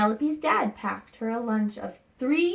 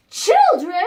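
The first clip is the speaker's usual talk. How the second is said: surprised reveal; 10 semitones higher, 10 dB louder than usual. A narrator's reading. Teacher reading the twist to her class, loud surprised reveal. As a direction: jump up high and loud on the twist word.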